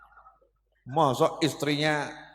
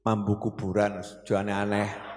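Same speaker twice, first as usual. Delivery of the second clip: husband's snide comment; 7 semitones lower, 5 dB quieter than usual. Retold or imitated. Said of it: "Anda sebagai suami komentar".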